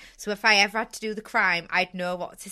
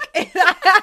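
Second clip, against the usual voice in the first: high, loud burst of laughter; big helpless high laugh; 11 semitones higher, 14 dB louder than usual. Real, not acted. Laughing at herself.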